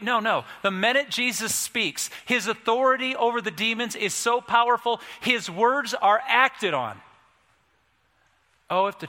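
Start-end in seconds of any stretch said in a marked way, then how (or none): 0.0-7.0 high, emphatic proclamation slowing to a finish; impassioned pulpit climax, pitched high; forceful preaching. Real, not acted. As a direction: drive each phrase home like a preacher.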